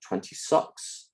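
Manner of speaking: imitated New Zealand short i in "twenty-six"; New Zealand accent. Lecturer quoting the New Zealand persona, New Zealand accent. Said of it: "the I sound in the middle of a word often ends up".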